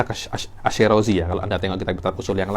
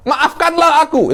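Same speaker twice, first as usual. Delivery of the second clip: high, loud plea for forgiveness; raised, imploring demonstration; pleading prayer; 6 dB louder than usual. Shown as a demonstration. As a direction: beg God to forgive you.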